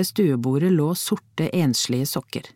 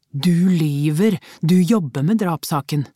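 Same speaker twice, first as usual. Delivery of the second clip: upset outburst; 4 dB louder than usual. A narrator's reading. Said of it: "utbrøt han opprørt".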